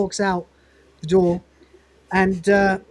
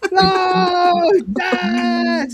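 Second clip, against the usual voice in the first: high, drawn-out wail of dismay; mock anguished cry; 10 semitones higher, 4 dB louder than usual. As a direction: cry out "no" in exaggerated despair. Acting out Dave's dismayed cry.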